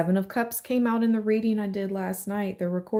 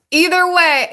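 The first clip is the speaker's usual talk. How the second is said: much higher and louder, thrilled; excited; 10 semitones higher, 10 dB louder than usual. Real, not acted. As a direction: blurt it out with delight. Gushing over the reading.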